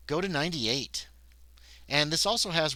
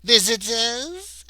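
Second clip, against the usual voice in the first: high, slow questioning maid voice, louder; maid asking; 10 semitones higher, 10 dB louder, much slower than usual. Acted. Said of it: "asked the woman".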